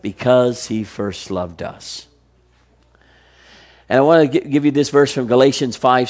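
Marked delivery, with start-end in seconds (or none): 0.0-2.1 slow, soft and low reply; softly revealed truth; tender answer. Acted. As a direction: let the answer land gently. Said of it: "answering his own question".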